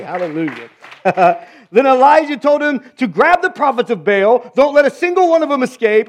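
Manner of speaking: ordinary talk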